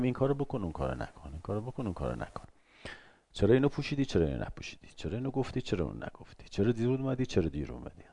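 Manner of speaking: a hint of a controlling parent; nagging parent. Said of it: "بریم هی بگیم".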